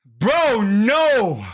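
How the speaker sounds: loud desperate no; shouting. Real, not acted. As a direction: yell in disgust. Reacts to pickle taste.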